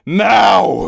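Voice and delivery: captain roaring at Hubble to leave; roared. Acted. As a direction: roar it as a final order. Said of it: "roared Cloud Wolf".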